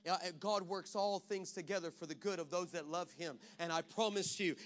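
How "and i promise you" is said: normal talking voice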